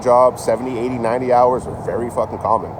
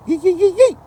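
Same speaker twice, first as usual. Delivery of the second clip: high, loud, rapid yee yee calls; excited yee yee; 4 dB louder than usual. Retold or imitated. Excited locals in Mississippi and Alabama, high yipping yee calls. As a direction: yip it like an excited Southerner. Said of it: "When they get excited".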